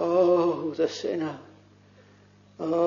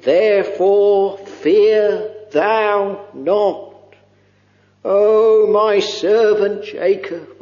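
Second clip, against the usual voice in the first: slow scripture reading; slower than usual. Acted. Preacher reads scripture slowly.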